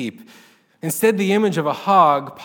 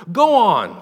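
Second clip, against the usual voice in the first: loud higher yell; 3 semitones higher, 8 dB louder than usual. A narrator's reading. Woman yelling defiance at heaven, loud. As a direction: throw it out over the pasture. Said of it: "she yelled".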